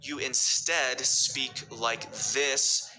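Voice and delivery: slow, drawn-out words; stretched words. Shown as a demonstration. Speech coach demonstrating word stretching, slow and drawn out. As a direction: stretch each word out long. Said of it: "you stretch some words".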